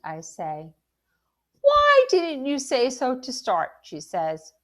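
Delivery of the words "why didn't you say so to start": loud, high exclamation with a long first word; exclaiming. A narrator's reading. Shop lady exclaims at the customer.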